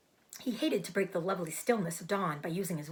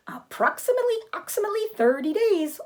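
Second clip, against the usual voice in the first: high, loud goose voice repeating a word; a goose who can't stop repeating; stuttering goose; 13 semitones higher, 9 dB louder, faster than usual. Acted. Goose repeats her words.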